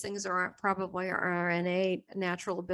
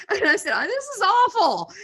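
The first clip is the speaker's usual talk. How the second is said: laughing; 11 semitones higher, 9 dB louder than usual. Retold or imitated. Laughing retelling.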